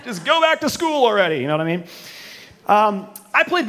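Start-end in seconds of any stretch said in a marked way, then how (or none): 0.0-1.4 half-joking higher yell; mock yell at kids. Fed-up parent yelling at kids about school, mock yell.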